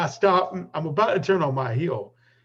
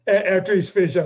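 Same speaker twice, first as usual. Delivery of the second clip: laughing through the words, higher and louder; 8 semitones higher, 8 dB louder than usual. Real, not acted. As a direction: laugh while describing the scene.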